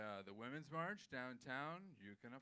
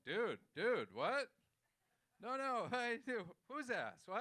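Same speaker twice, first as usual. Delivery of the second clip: loud, high, incredulous outburst; hammed-up shocked questioning; mock disbelief; 8 semitones higher, 8 dB louder than usual. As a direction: react like a stunned eavesdropper. Imagined eavesdroppers react shocked.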